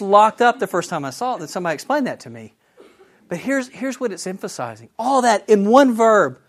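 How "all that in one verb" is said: high, loud and slow; marveling. Real, not acted. Engineer teacher to class, amazed at one verb, high and loud. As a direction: savor each word in amazement.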